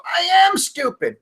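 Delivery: high-pitched mock declaration of stupidity; mock realization. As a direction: proclaim it in an exaggerated high voice. Brain's conclusion acted out.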